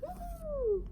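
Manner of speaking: high, soft, sustained woo; whooshing whoop. A narrator's reading. Witch flies off on her broomstick, high woo. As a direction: hold a high flying-away woo.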